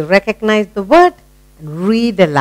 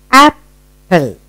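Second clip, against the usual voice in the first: "Apple" broken in two by a pause; split into syllables. Shown as a demonstration. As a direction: model "Apple" one syllable at a time. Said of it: "Keep on saying the word".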